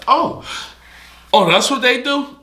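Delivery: high squeaky surprised oh. Retold or imitated. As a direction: act out a sudden shocked realization. Surprised realization quoted.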